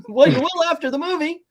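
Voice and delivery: very high, loud chirpy comeback; cheerily oversell it to children; mock pitch to kids. Pitch man replies to the kids.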